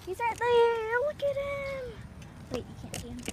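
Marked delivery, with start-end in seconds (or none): 0.0-1.8 excited. Real, not acted. Pointing out friend.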